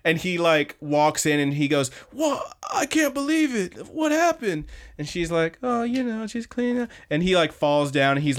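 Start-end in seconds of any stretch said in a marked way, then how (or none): 2.1-4.6 high-pitched astonished gasping delight; hammed-up, high disbelief; amazed. Acting out his amazed homecoming. 5.6-6.9 coy. Retold or imitated. Acting out her coy answer.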